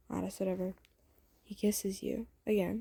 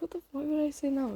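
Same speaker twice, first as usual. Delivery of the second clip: high-pitched, incredulous question; acted disbelief; 7 semitones higher, faster than usual. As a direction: react as if the worry is absurd. Acted disbelief at his worry.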